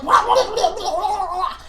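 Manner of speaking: loud high yapping; imitating barking dogs; mock dog barks. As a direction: bark like hounds, laughing. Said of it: "they were like".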